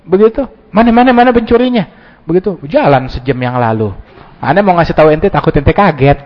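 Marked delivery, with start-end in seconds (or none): 0.7-1.8 high, fast panicked questions; alarmed questioning. Acted. Victim hearing his motorbike was stolen, panicked fast questions. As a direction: scramble to find the thief. Victim asks where thief is.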